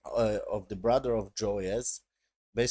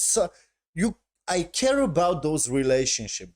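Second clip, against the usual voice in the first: raised, insistent fan voice; emphatic enthusiasm; 5 semitones higher, 5 dB louder than usual. Real, not acted. Fan telling viewers about those relationships, emphatic and excited. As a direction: push it out higher and louder, with conviction.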